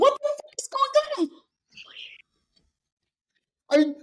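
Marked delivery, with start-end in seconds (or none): 0.0-1.4 very high strained half-whispered squeal; hushed, high-pitched imitation of shock; mock alarm. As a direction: squeak it like a startled sleeper. Mimics woken household reacting.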